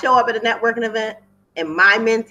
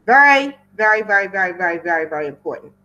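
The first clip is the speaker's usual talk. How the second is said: starts loud on very, then fades away; fading emphasis; 3 dB louder, faster than usual. Shown as a demonstration. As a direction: hammer very then let it trail off. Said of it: "Did I stress that enough?".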